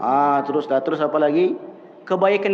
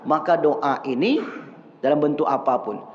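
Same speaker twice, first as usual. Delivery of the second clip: ordinary talk, nothing marked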